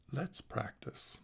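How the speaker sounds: quiet, slowed invitation to begin; soft. Acted. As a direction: ease into it, slow and hushed. Calm opening of the practice.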